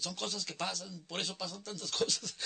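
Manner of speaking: mock the drunk's catchphrase while laughing; drunk heckler. Host imitating the drunk fan's catchphrase, laughing. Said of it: "traía unos gritotes".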